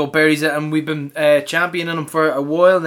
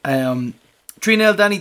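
normal talking voice

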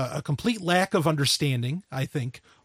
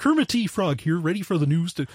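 newscaster frog, a Kermit impression. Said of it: "[name] has a great Kermit the Frog voice".

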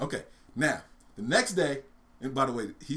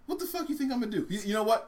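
higher-pitched put-upon character voice; acted mouse reply, raised pitch; exasperated mouse; 8 semitones higher than usual. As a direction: answer a panicking friend, exasperated.

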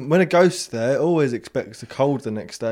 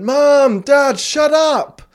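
put-on high, loud, slow child's yell; child shouting; 11 semitones higher, 7 dB louder, much slower than usual. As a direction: yell it drawn out from bed at the parents. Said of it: "I went".

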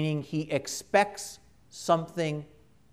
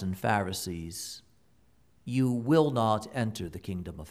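solemn Gospel reading; 6 semitones lower than usual. Acted. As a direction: read scripture aloud with gravity.

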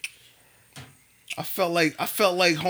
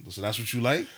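dry, lowered sarcastic question; 7 semitones lower, 3 dB quieter than usual. Acted. Man questioning wife about pics in her phone, sarcastic. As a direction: needle them with a deadpan question. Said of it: "say it like that sarcastically".

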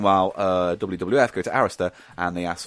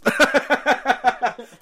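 high burst of real laughter; laughing; 4 dB louder than usual. Real, not acted. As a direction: crack up at a partner's silly voice. Laughs at the accent.

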